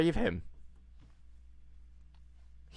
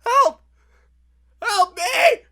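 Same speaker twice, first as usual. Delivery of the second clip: very high, loud screams for help; screaming for help; 8 dB louder, slower than usual. Acted. Tuxedoed man screaming to the crew for help, high and shrill. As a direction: shriek for help across the water. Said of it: "start screaming".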